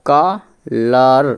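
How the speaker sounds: কালার drawn out slowly, ka-lar; slow spelled-out pronunciation. Shown as a demonstration. Teacher slowly sounding out কালার for pupils. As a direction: stretch কালার out syllable by syllable. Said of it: "তাহলে উচ্চারণটা বুঝে গেল".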